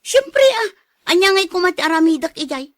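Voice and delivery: loud, fast, high retort; angry retort. Acted. Wife fires back at her jealous husband, loud and angry. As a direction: throw it back at him, fed up.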